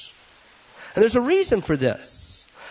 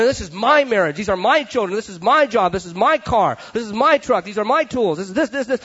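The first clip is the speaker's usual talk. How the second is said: each my leaned on, louder; possessive listing; 3 semitones higher, 3 dB louder than usual. Retold or imitated. Mock owner listing what is "my" marriage and truck. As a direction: stake a claim on every item.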